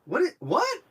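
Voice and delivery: mock-stunned, the second one leaping up high; baffled disbelief. Viewer hearing the trailer voice, acting baffled disbelief. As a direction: react as a thrown-off viewer.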